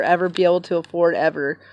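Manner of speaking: usual speaking voice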